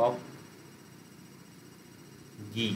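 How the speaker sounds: "অজ্ঞি" pulled apart with a long pause inside; broken into syllables. Shown as a demonstration. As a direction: hold a gap before the last syllable of "অজ্ঞি". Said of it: "ওটাকেও syllable ভেঙে নিতে হবে". ("অজ্ঞি" syllable ভেঙে)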